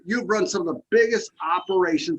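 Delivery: ordinary talk, nothing marked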